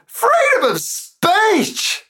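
very high, slow, wailing mock cry. Poet voicing a patriot in the poem, wailing mock cry. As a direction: stretch Freedom into a hammed-up wail.

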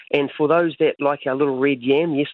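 usual speaking voice

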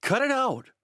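put-on a frightened boy yelling at a drunk man; shouted. A narrator's reading. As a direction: shout him off your mother. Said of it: "I shouted".

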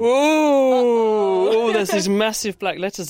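loud, high gasp of surprise; surprised. Real, not acted. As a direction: react to the shocking result. Breathalyser shows fail.

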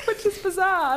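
laughing high through the words. Real, not acted. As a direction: crack up while saying it. Laughing at her own joke.

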